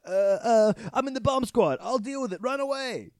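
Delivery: high, mock-panicked voice; high, hammed-up panic; panicked Hal. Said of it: "Hal goes".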